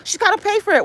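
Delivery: high, mocking mimic of critics; mocking gossip. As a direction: sneer at the people gossiping. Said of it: "acting all funny".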